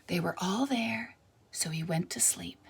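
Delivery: soft unvoiced whisper; sleepy whisper. A narrator's reading. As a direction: hush them toward sleep. Peddler falls asleep, lulling.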